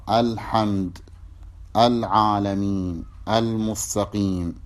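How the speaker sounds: الحمد العالمين المستقيم each with the lam sounded clearly; clear lam shown. Shown as a demonstration. Teacher demonstrating moon letters to students, clear lam. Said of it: "فتقول القمر".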